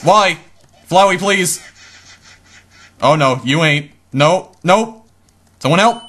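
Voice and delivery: panicked pleading. Acted. Captured, pleading for help.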